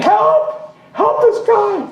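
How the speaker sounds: high, urgent cry for help; retold scream for help. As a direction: call out desperately for rescue. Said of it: "she screamed".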